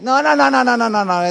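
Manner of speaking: loud run of rapid refusals; brushing away a lame excuse; dismissive interruption. Acted. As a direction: cut off the excuse, starting high and falling. Cuts off an acted excuse.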